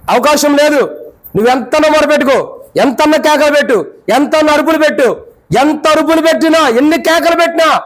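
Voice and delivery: pleading sermon at full force; fervent preaching. Acted. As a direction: preach it out with urgency.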